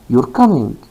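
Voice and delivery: "You're coming" with a falling tune, as a fact; statement intonation. Shown as a demonstration. Teacher showing learners a statement, falling intonation. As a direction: let the pitch drop on "coming", no question in it. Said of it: "This is a statement, not a question".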